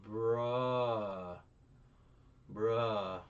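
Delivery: loud, flat disbelief; disbelieving bruh. Real, not acted. Reacting to the cruel plan, loud disbelief.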